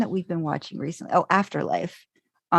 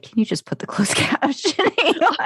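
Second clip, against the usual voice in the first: high, laughing imitation of a request; laughing retold request; 10 semitones higher than usual. Friend quotes Ted wanting captions, laughing high-pitched imitation. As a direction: squeeze the quote out through laughter.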